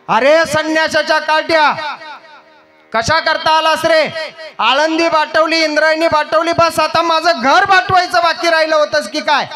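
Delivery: put-on angry villager shouting at a boy begging alms; contemptuous householder. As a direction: spit the insults at the beggar and drive him off. Householder insults and drives him away.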